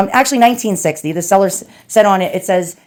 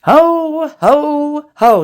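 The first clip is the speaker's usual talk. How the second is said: high, hearty Santa laugh; jolly ho ho ho; 6 semitones higher, 3 dB louder, much slower than usual. Pretend. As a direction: belt it out like Santa Claus. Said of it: "It's not Christmas".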